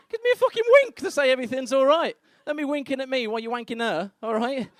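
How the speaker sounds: loud, high-pitched comic outrage; indignant rant. Acted. Partner ranting about the doctor's wink, loud and outraged. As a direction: burst out in hammed-up indignation.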